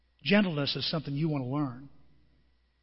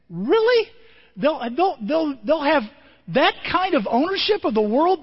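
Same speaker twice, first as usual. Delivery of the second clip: high, loud mock disbelief; incredulous; 8 semitones higher, 4 dB louder than usual. Preacher mocking the verse to his congregation, incredulous. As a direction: sound shocked that the meek win.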